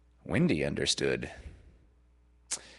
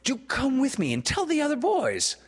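high, louder eager urging; urgent pleading; 4 dB louder than usual. A narrator's reading. Peter urging Wendy to come along, high and eager. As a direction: plead excitedly, pitched right up.

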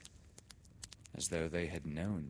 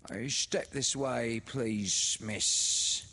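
put-on high, louder, oily fawning old woman; 6 semitones higher, 6 dB louder, much slower than usual. A narrator's reading. A little woman leading Laura down the passage, oily. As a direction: smarm it, ushering her along. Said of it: "she said in an oily voice".

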